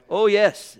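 ordinary talk

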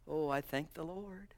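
ordinary talk

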